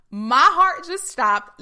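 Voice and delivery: loud, high, slowed-down shock; her own stunned reaction. Retold or imitated. Woman re-enacting her call with Kate, loudly stunned. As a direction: belt it out in amazed disbelief, stretching the words. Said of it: "I literally said it like that".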